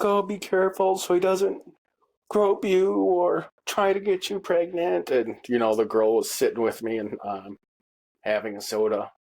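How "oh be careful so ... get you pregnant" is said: high-pitched imitation of an ex-wife; mock ex-wife warning. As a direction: mimic her warning, pitched well up. Voices ex-wife warning on the phone.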